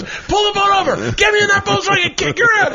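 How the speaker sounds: high mock shout demanding the boat; hammed-up furious yell; imitated angry shout. Bobby yelling at the driver to pull over, mock shout. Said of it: "he goes".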